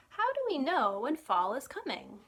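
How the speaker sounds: bright, lively storybook reading; reading to children. Acted. Librarian reading a picture book to children, lively storytime voice. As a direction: read it like storytime for little ones.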